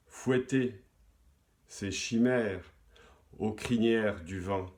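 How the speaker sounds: measured poem reading with long pauses; poetry recitation. Acted. Host reciting a listener's poem to the audience, measured poetry recitation. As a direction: recite verse phrase by phrase, letting key words swell.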